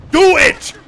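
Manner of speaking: loud, high burst of urging; eager shout. Real, not acted. Gamer baiting an enemy into a trap, eager shout. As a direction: bark it as the trap springs.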